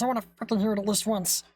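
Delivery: normal talking voice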